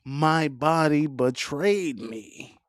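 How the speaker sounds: slow, drawn-out melodramatic wail; Tyler Perry, dragged out. Retold or imitated. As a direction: stretch every word of the lament. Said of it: "you be sounding like Tyler Perry".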